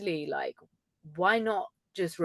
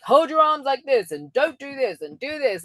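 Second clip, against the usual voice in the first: mock bossy coach, a touch louder; 6 semitones higher, 6 dB louder than usual. Mocking other coaches, she apes a bossy coach's orders. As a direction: bark rules like a strict coach. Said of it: "coaches that are like".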